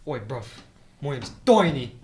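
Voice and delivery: mock British tough guy, name punched loud; British accent. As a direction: introduce yourself in broad fake British. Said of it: "they call him Tiny".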